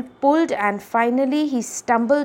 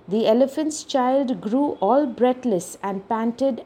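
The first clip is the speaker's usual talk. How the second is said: ordinary delivery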